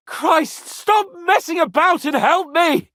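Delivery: loud, high call for help; urgent shout. Real, not acted. Pinned in a fight, shouting at Henry for help. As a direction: yell at him to help now.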